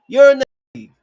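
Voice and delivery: loud, high-pitched jab of disgust; disgusted call-out. Real, not acted. Calling out the men.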